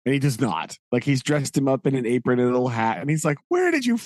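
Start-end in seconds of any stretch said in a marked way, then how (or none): 3.5-4.0 incredulous. Retold or imitated. Jaggers eyes the false witness.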